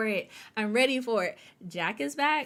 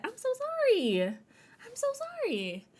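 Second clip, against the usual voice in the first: much higher, squeaky sorry; high apology; 13 semitones higher than usual. Real, not acted. Apologizing to a viewer for her name, high squeaky sorry.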